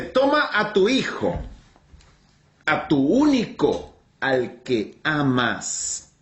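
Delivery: solemn divine command, drawn out word by word; God commanding Abraham. Acted. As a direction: proclaim it as God, pausing after each phrase. Acts God addressing Abraham slowly.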